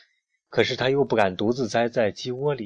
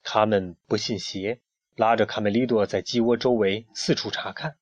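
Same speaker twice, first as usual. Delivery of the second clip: plain reading voice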